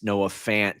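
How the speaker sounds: usual speaking voice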